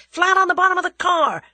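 high, loud shouted command; urgent cry. A narrator's reading. Julius orders the girl to take cover, crying out. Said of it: "cried Julius".

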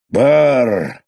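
loud, high brr of cold; shivering brr. A narrator's reading. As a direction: shake off the cold aloud. Man coming in from blizzard.

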